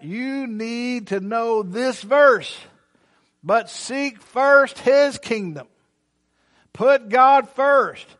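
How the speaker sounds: loud, high, rising preacher's cry; preaching at full force. Acted. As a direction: preach it hard to the congregation. Preacher drives verse home slowly.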